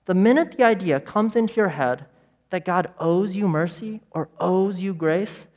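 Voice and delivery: ordinary delivery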